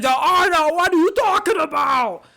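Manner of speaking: high, pushed-up whiny voice; mock the actor's pitiful whine; a hammed-up high whine, pleading and put-upon; Adam Sandler impression. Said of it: "And he talks like this".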